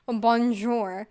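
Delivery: a loud, hammed-up fake French bonjour; fake French accent. Gingerbread cellmate greets Shrek, hammed-up fake French accent. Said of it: "with a highly fake French accent".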